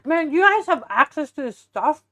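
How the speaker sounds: talking through a mouthful of taco; words round a big bite; mouth full. Real, not acted. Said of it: "I'm talking with my mouth full".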